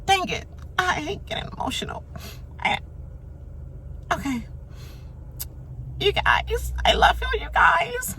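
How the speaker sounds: high squeaky put-on voice, fake tears building; mocking Keisha's teary speech. Mocking Keisha choking up during her eviction speech, high teary voice. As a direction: parody a tearful farewell, high and breathy. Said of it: "all Keisha could come up and do was".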